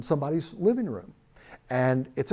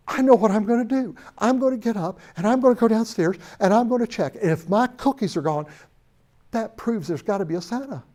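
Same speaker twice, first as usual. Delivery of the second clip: put-on determined little boy; 7 semitones higher, 5 dB louder than usual. Billy resolves to check cookies.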